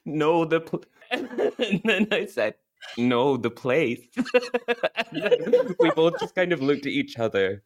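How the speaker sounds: helpless giggles breaking up the story; laughing while telling. Real, not acted. Confessing an awkward tourist encounter to a friend, laughing. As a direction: laugh through the retelling. Cracking up retelling own embarrassing blunder.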